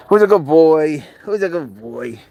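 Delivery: doting pet talk, loud then easing off. Real, not acted. Owner praising the pet serval, doting pet talk. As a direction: coo it at a beloved pet.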